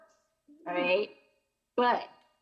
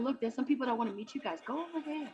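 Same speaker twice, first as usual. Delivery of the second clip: hushed sales pitch; 6 dB quieter, faster than usual. Retold or imitated. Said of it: "I'm like".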